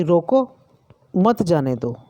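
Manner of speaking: रोको rising, long pause, then मत जाने दो; teacher's demo isolating रोको before मत जाने दो; pause after रोको. Shown as a demonstration. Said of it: "एक तो ये".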